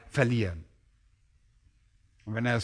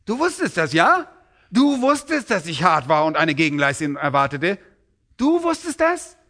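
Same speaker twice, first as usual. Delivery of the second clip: much higher, mocking sarcastic master; sarcastic disbelief; 4 semitones higher than usual. Master mocking the servant's excuse, sarcastic. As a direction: sneer back his own words at him. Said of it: "Mit anderen Worten sagt er".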